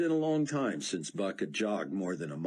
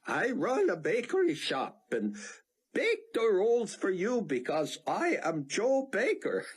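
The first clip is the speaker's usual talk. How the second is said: hammed-up American accent, higher, with laughs breaking in; a clumsy fake American accent, pitched up and giggling; labored American accent; 14 semitones higher, 3 dB louder than usual. Theon fakes an American accent for Buck, hammed-up. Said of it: "in a ridiculously labored American accent".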